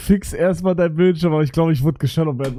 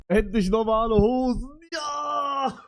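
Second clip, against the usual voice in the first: excited; 6 semitones higher, slower than usual. Real, not acted. Cheering about new trousers.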